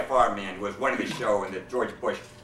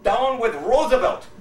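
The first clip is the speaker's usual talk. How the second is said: high, loud, slow retort; indignant shout; 11 semitones higher, 7 dB louder, slower than usual. Acted. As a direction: fire it back in a huff. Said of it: "And he says".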